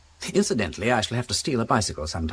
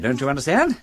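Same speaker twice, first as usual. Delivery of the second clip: ordinary delivery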